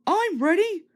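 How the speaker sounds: high eager engine; small engine voice. Acted. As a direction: chirp it ready to go. Henry eager.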